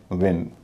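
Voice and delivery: विन with a short i; short i sound. Shown as a demonstration. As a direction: say विन with a brief "ih" vowel. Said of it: "और उच्चारण हो रहा ई".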